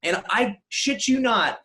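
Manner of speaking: ordinary talk, nothing marked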